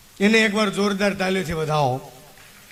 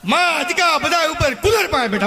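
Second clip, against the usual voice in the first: laughing; 8 semitones higher than usual. Acted. Mocking laughing.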